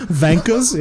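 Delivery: "Vankers" with a German V for W, laughing; mock German pronunciation. Co-host echoing the German bit, "Vankers" with V for W. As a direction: say "Vankers" high, through laughter.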